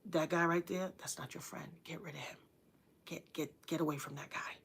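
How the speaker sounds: very soft, low warning; hushed warning. Retold or imitated. Mother warning her kids about a bad friend, hushed. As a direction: warn your kid in a low hush.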